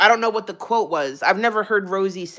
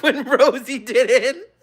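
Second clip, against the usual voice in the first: higher, slow, breaking into laughter; laughing while reading; 4 semitones higher, slower than usual. Real, not acted. Streamer reads viewer's ER comment, cracking up laughing. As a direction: crack up through the words.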